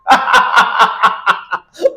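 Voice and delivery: loud breathy burst of laughter; real laughing fit after the gun remark. Real, not acted. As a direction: crack up out loud.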